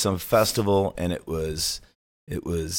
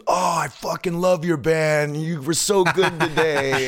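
higher, slow, gushing praise with a laugh; gushing fan; 8 semitones higher, slower than usual. Retold or imitated. Evan suddenly gushing to the host about his band, hammed-up delight. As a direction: overdo the sudden adoration, slowly. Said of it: "He goes".

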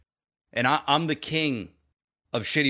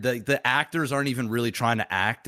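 usual speaking voice

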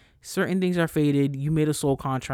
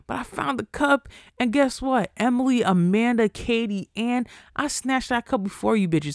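much higher, triumphant gloat; gleeful gloating; 8 semitones higher than usual. Real, not acted. Host gloats to friends she snatched the cup first. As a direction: taunt friends with a high gleeful crow.

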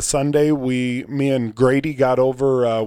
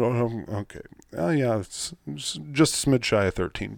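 ordinary delivery

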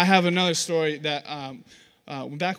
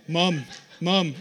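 lightly voiced nagging little boy; repeated calls for mother's attention; pestering baby Stewie. Baby boy at mother's bedside, pestering. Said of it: "this little ingenious little baby guy who".

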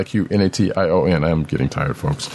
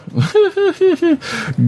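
high-pitched laugh. Real, not acted. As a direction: burst of high, squeaky laughter. Laughs at flubbed name.

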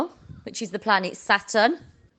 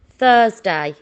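ordinary talk

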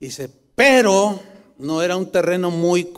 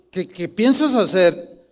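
normal talking voice

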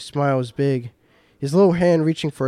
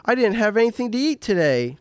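put-on high-pitched little-kid whine; plaintive, much higher childish complaint; whiny protest; 8 semitones higher than usual. A narrator's reading. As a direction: whine it like a hungry four-year-old. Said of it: "protested with a whine".

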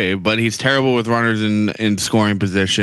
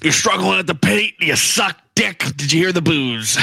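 gruff reporter voice; 5 semitones higher than usual. Pretend. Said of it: "fucking cigarette and he was like".

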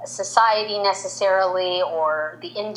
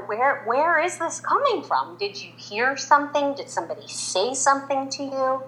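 higher-pitched, probing mom questions; retold concerned mother; 7 semitones higher than usual. Mother asking her upset five-year-old, retold concern. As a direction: lightly act a parent asking carefully, pitched up.

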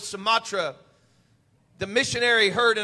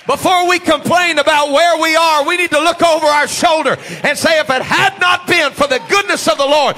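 loud, rising preacher's cry; preaching at full force; 5 semitones higher, 11 dB louder than usual. Real, not acted. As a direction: build the sermon to a shout.

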